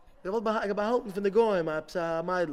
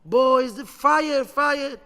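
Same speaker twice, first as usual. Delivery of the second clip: loud, high shouts of fire; screaming alarm; 7 semitones higher, 12 dB louder, much slower than usual. Retold or imitated. Rabbi alerting the yeshiva boys to a fire, screaming. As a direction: raise the alarm at full voice. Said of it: "he starts screaming".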